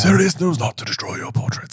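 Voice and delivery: Dumbledore voice. Acted. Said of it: "said Dumbledore".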